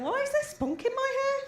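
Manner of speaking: high-pitched mock bewilderment; bewildered question. Waking from a booze blackout in a Travelodge, bewildered. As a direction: wake up baffled, voice pitched right up.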